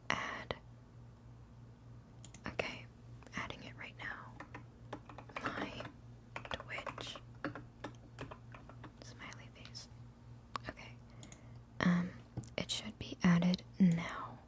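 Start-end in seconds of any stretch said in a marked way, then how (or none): 0.1-10.9 slow, hushed whisper while typing; absent-minded slow whisper; whispering to self. Real, not acted. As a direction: whisper each word as you type it.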